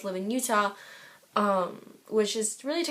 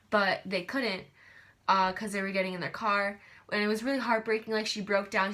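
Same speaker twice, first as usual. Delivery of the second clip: normal talking voice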